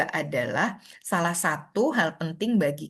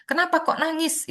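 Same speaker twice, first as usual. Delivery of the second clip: much higher, puzzled scolding question; dismissive adult; 10 semitones higher than usual. Retold or imitated. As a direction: act out the wrong response to a crying child. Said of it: "Itu nggak". (menegur anak yang menangis, nada heran)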